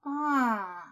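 a stretched, ominous questioning ah; puzzled and menacing drawn-out ah. Acted. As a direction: drawl the ah, puzzled yet threatening. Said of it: "недоумевающе и грозно протянула".

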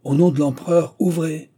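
loud, high order through the door; commanding shout. A narrator's reading. Police voice ordering the door opened, shouting. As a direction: bark it through the door for the emperor. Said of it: "répétant".

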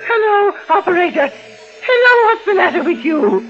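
high, breathless pleading; panicked. Acted. Frightened woman pleads with the operator in panic. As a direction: beg for help in a rush.

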